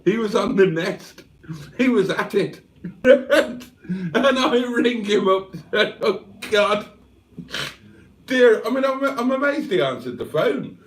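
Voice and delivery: can barely get it out for laughing. Real, not acted. Telling chat about phoning his friend at a bad moment while laughing. Giggling over friend's phone story.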